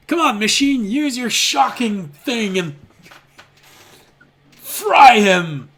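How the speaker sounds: high, loud jeering rogue voice; cruel rogue. Acted. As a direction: jeer at the machine like a gleeful thug.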